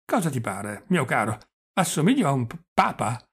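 put-on stammering, chatty old prince; halting old man, catching on his consonants; doddering old prince. Old prince reminiscing to his nephew, doddering and stammering.

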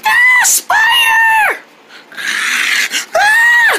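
put-on child terror scream; panicked scream. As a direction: shriek and run from it. Spider following them.

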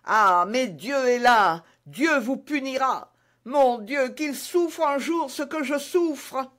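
high, slow, strained cry of suffering; agonised curse. A narrator's reading. Dying soldier curses his supposed poisoner, in agony. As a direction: call down God's punishment in agony.

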